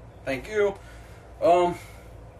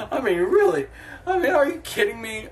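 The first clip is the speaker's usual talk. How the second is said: exasperated disbelief; 6 semitones higher than usual. Real, not acted. Exasperated rant about Super Bowl.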